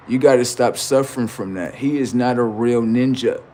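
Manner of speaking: ordinary delivery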